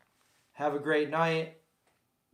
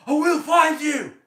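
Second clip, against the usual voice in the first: loud, high, impassioned movie-hero shout; bad impression, bellowing a desperate film line; hammed-up dramatic movie quote, shouted at full voice; Daniel Day-Lewis; 11 semitones higher, 9 dB louder than usual. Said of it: "that was a bad impression of Daniel Day-Lewis".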